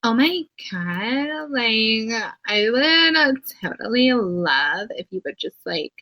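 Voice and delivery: mock valley girl with stretched vowels; exaggerated valley girl accent, dragging every phrase. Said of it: "Valley girl accents piss me off".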